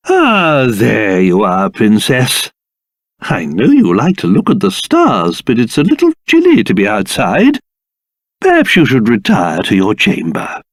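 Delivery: low, soothing fatherly voice; gentle concern. Acted. Father finding the princess outside at night, tender.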